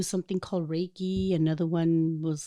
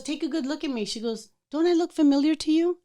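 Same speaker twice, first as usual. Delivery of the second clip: knowing question; 8 semitones higher than usual. Retold or imitated.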